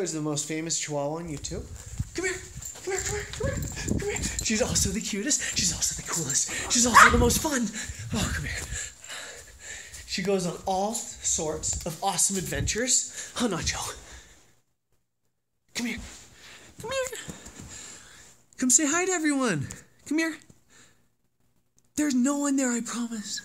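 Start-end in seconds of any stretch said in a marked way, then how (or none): 2.1-4.2 much higher, soft coaxing call; high dog-calling. Real, not acted. 15.7-23.4 much higher sing-song pet talk; high coaxing call. Real, not acted. Calling the dog.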